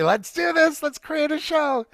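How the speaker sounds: high, bright retold call to action; excited. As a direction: launch it like a rallying cry. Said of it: "just immediately".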